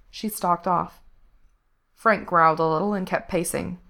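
ordinary delivery, nothing marked

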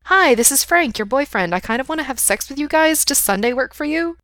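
high, teasing mock phone call; mocking friend. A narrator's reading. Friend acts out his phone call for him, mocking.

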